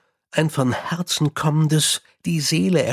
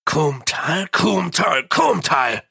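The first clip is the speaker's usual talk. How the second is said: a repeated name that swells to a shout and drops; thundering; 2 semitones higher, slower than usual. A narrator's reading. Mumm at his men, thundering in anger. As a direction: pound it out like a fist on the table. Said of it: "donnerte Mumm".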